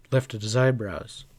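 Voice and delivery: usual speaking voice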